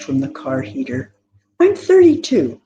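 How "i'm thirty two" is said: bold, high declaration; forthright. A narrator's reading. Tilia tells her sisters her age, forthright and bold. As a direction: state it plainly, head up. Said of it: "Tilia stated in a forthright tone".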